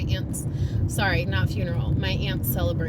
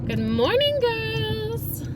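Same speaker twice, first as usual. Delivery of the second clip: cute animal greeting; 13 semitones higher, much slower than usual. Real, not acted. Greeting the cows.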